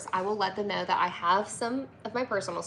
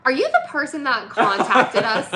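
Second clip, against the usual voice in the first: teasing laugh; 5 semitones higher, 9 dB louder than usual. Real, not acted. Teasing husband about fan.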